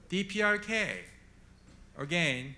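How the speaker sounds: usual speaking voice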